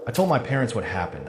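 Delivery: ordinary delivery, nothing marked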